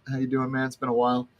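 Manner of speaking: normal talking voice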